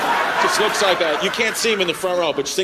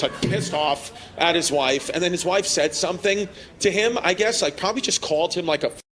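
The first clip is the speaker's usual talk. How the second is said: usual speaking voice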